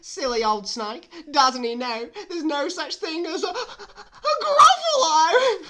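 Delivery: high, loud mouse voice climbing to a shriek; gleeful squeaky mouse, drawing out the ending; cheeky mouse. Acted. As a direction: crow it, then squeal the last word. Mouse's lines in the story.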